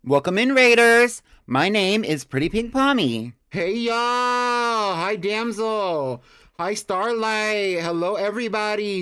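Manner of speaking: high bubbly streamer greeting; cute anime streamer. Acted. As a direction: squeal hello in a cute anime voice. Greeting raiders, persona Pretty Pink Pommy.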